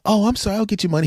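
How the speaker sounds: hint of a sheepish club owner; club owner apology. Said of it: "club owner apologizing".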